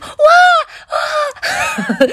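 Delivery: amazed wow. Retold or imitated. Imitating how people react to her game roles, amazed. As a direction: squeal like a fan who just heard the big news. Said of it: "别人都说".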